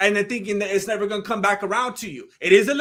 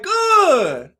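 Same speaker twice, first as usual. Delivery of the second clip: high, loud, put-on "all fine"; over-cheery reply; 11 semitones higher, 7 dB louder than usual. Answering a passerby who asked how it's going, over-cheery. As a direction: belt it out with exaggerated cheer.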